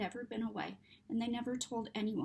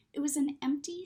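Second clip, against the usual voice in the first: usual speaking voice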